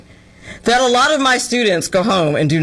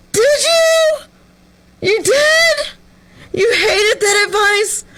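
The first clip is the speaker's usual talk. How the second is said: much higher, sarcastic "did you?"; mock shock; 11 semitones higher, slower than usual. Answering the TikToker's complaint, high sarcastic shock. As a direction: feign amazement at her hating it.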